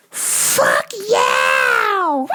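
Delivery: high, screeching crazed shout; manic yell. Retold or imitated. Woman nearly hit in traffic, high manic screech. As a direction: screech it out wildly, like someone possessed. Woman yells at honking car.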